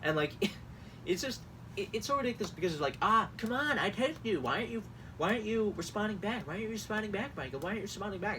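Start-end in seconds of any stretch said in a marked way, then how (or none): 3.0-8.4 higher, whiny pestering voice; jealous ex-boyfriend. Acted. Host mocks campaign texts as a jealous ex-boyfriend. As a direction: plead like a needy ex. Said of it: "it's like a jealous ex-boyfriend".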